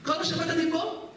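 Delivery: high, exasperated protest mid-blessing; indignant rabbi. Retold or imitated. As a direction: squeak out his outrage at the interruption. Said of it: "ואני עושה".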